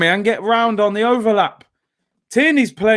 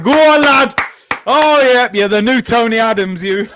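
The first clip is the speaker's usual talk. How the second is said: excited cheer; 4 semitones higher, 6 dB louder than usual. Real, not acted. Cheering a defender's run.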